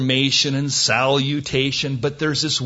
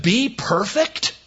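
slow, higher, rising "Be perfect?"; incredulous question; 7 semitones higher, slower than usual. Acted. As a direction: drag out "Be perfect?" in disbelief.

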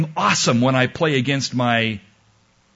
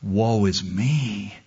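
lament; 4 semitones lower, 4 dB quieter, much slower than usual. Retold or imitated. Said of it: "And I cried".

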